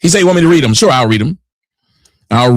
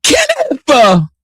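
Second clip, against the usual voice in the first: much higher mock whine of the name; mocking her whine; 13 semitones higher than usual. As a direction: parrot her squeal with scorn. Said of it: "you bitches who talk like this".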